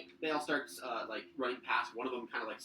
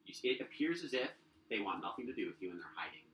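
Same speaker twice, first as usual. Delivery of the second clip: ordinary delivery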